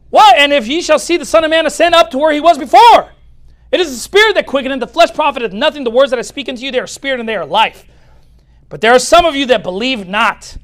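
loud, high, forceful scripture proclamation; insistent preacher's reading, starting at full volume and easing slightly; doubling down. Acted. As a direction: thunder the verse out at the doubters. Jesus doubling down, voiced from scripture.

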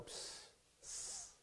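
unvoiced psst psst hiss; mocking hiss. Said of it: "they'll go".

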